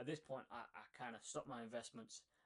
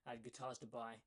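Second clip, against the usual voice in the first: normal talking voice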